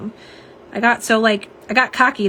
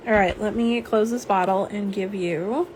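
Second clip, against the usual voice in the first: usual speaking voice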